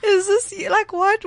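high, loud squeal of recognition; excited. Retold or imitated. Student spotting a grade four friend at orientation, excited. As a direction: re-enact thrilled surprise, voice shooting up. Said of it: "I was so excited to see her".

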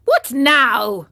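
loud, indignant demand; angry. A narrator's reading. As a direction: snap it at the teasing boys. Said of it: "he said angrily to them".

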